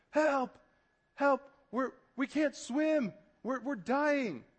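high, drawn-out cries for help; mock drowning panic. People in the water beside a boat, mock panicked cries. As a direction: play-act a desperate swimmer calling out.

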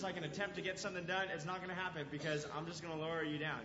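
usual speaking voice

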